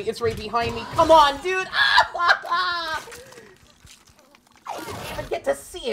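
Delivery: loud panicked cursing; frantic high yelling in panic; scared horror game streamer. Real, not acted. Alien catches him, panic.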